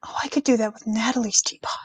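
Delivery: quick conspiratorial whisper. Real, not acted. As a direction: whisper the idea to yourself. Hushed aside while chatting.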